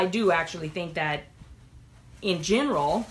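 ordinary talk, nothing marked

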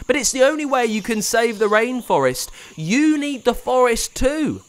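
high, loud urgent plea; lightly voiced earnest boy; pleading boy. A narrator's reading. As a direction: beg her to see sense. Pablo pleads.

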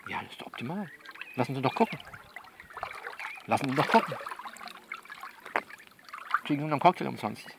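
normal talking voice

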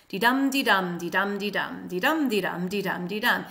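dee-DUM chant, stress on each dum; even singsong dee-DUM pulse; iambic beat. Shown as a demonstration. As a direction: tap out "dee-dum" in a regular rhythm. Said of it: "is iambic tetrameter".

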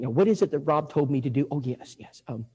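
soft, low, halting mumble to oneself; acting out a flustered novice visitor; nervous thinking aloud. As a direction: fumble for the words, trailing into ums. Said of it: "Betty begins to think".

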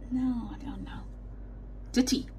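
normal talking voice

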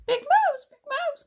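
very high-pitched little mouse call. A narrator's reading. Little Mouse wakes Big Mouse at night, high squeaky calling. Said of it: "called Little Mouse".

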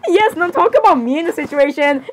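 ordinary delivery

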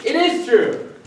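high, loud triumphant aha; triumphant gotcha. Acted. Husband catching his wife out, high loud triumphant aha. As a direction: pounce on it, caught you.

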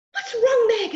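put-on alarmed girl asking the dog what's wrong; a girl asks her growling dog, worried.

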